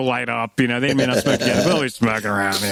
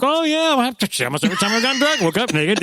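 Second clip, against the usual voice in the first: high, fast put-on voice of a breezy mom; mock tipsy mom; 9 semitones higher than usual. Mother on a pot show admitting it, high and quick. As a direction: toss it off like a carefree mom. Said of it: "the mother was like".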